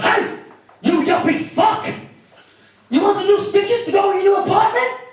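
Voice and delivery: high, loud mock-threatening shout; angry biker. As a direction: yell a threat as an enraged biker. Angry biker grabs him, threatens.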